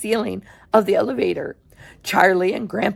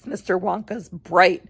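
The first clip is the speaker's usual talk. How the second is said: usual speaking voice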